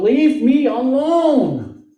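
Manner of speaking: high, slow, loud cry to be left alone; retold scream. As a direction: cry it out, worn to the end. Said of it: "he screams out".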